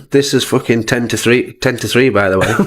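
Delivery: usual speaking voice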